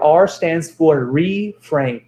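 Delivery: R stands for reframe drawn out slowly; teacher stretching out the key word; slow, deliberate. Shown as a demonstration. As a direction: spell it out slowly for the class. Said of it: "The next letter is R".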